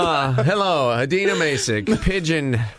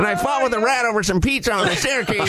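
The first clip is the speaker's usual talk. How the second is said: lightly higher scruffy pigeon voice; 5 semitones higher than usual. Pretend. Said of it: "this is Bielko coming for his breakfast".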